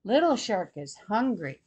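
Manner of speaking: ordinary delivery, nothing marked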